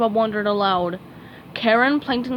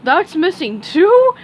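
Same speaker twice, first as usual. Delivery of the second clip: higher, louder astonished question; slow dopey surprise; puzzled starfish gasp; surprised; 8 semitones higher, 8 dB louder, slower than usual. A narrator's reading. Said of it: "Patrick asked with a surprise".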